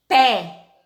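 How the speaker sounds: "pé" loud, with a wide-open É; open vowel. Shown as a demonstration. Teacher reading group two aloud, open vowel. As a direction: punch "pé" on its open E. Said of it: "Lê em voz alta".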